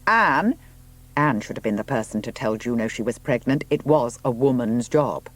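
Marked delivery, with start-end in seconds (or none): 0.1-0.5 loud, higher call across the house. A narrator's reading. Robert calling through the house for Anne, loud. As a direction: call for her from another room.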